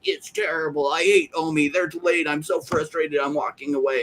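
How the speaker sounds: hammed-up grumbling investor in the barber chair; mock frustrated holder. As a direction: moan each line like a quitter. Imagined frustrated Omi holder.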